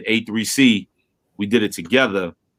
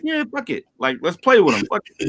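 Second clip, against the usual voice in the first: playful defiance; 7 semitones higher than usual. Retold or imitated. Retold mischievous decision.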